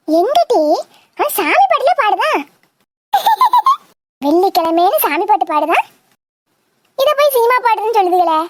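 ordinary talk

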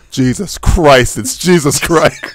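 slow, drawn-out announcement; slow Jesus reveal. Retold or imitated. Justin announces himself to grocery shoppers, slowly. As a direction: stretch each word out slowly. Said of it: "I want you to be at a grocery store and go".

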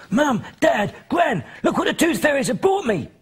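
put-on high, thrilled little boy calling out; a boy shouting his wonderful news to the family; excited call. Boy calling his family to see the fairy gift, high excited call.

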